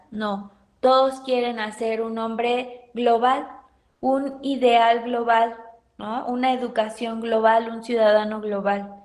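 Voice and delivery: ordinary talk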